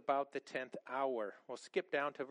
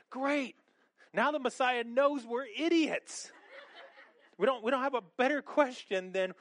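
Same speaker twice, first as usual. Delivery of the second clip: higher and louder mock despair; exasperated sarcasm; 7 semitones higher, 5 dB louder than usual. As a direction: groan at your own dumb question, much higher. Disciple embarrassed by the question.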